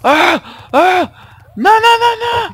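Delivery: high shrieks of panic; panicked screaming. Real, not acted. Shot at, panics. (cris de panique aigus)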